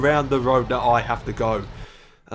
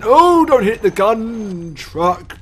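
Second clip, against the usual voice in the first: high, drawn-out cry of alarm; alarmed outcry; 7 semitones higher, 6 dB louder, much slower than usual. Real, not acted. Driver fearing for the gun truck, alarmed outcry. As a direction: cry out loudly, then stretch the words. Calls out during driving mishap.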